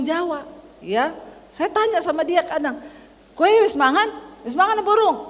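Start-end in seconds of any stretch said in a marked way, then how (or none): 3.4-5.2 high-pitched Javanese question, retold; nada tinggi. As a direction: ask it high, like picking a fight. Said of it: "Nadamu tuh tinggi". (nada tinggi seperti ngajak berantem)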